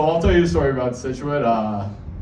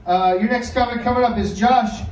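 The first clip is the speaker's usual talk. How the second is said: emcee intro; 8 semitones higher than usual. Acted. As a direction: hype up the next comic like a club host. Host announcing the next comic.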